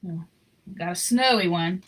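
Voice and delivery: plain reading voice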